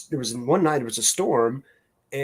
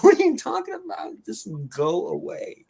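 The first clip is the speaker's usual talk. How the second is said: incredulous; 10 semitones higher than usual. Retold or imitated. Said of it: "I'm like".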